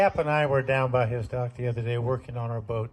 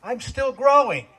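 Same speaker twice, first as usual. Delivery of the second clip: indignant protest; 6 semitones higher, 4 dB louder than usual. Retold or imitated. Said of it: "And I said".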